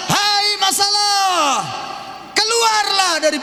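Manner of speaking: slow, high shouted command at the problem; loud cry. Shown as a demonstration. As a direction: order the problem out, full voice. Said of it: "engkau harus berseru keras". (seruan keras dan lambat)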